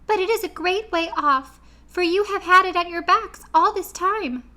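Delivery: Queen of the Mice to her friends, squeaky and kind; squeaky little voice. A narrator's reading.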